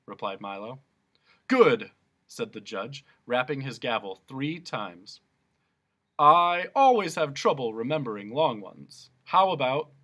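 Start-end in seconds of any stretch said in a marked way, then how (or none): 1.5-1.9 loud, high and pleased; booming judge approval. Acted. Judge answers Milo's choice of sentence, booming. 6.2-9.9 louder, higher bluster; loud pompous judge. Acted. Judge muses to Milo about sentences, blustering loudly.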